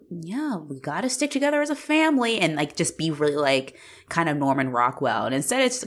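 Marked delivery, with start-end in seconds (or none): 0.1-2.4 high, mock-wholesome line; corny sincerity. Writers making a family speech, corny. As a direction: pitch it up like a cheesy family movie. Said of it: "do the corny thing of".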